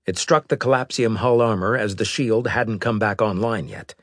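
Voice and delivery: usual speaking voice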